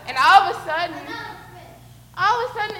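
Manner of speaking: high, loud burst of suspense; sudden excitement. Pretend. Leader telling kids the fish suddenly bite, excited. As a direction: break the wait with a sudden jolt.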